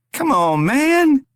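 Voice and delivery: high, loud desperate plea; desperate cry. A narrator's reading. Bound Rory cries out to his tormentor, desperate. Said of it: "Pure desperation emphasized the words".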